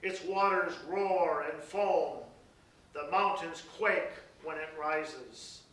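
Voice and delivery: dramatic, rising scripture reading; solemn Bible reading, building to loud peaks. Acted. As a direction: proclaim the psalm with swelling force. Reads Psalm 46 aloud.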